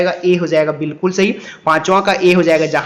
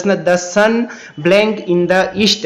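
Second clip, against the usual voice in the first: slow read-aloud; much slower than usual. Shown as a demonstration. Reads question.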